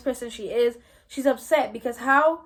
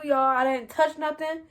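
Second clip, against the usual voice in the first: normal talking voice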